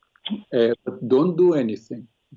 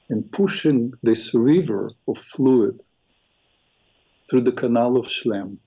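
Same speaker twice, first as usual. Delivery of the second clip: slow, low guided meditation voice; 3 semitones lower than usual. Acted. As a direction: ease them into calm breathing. Leads a guided eye meditation.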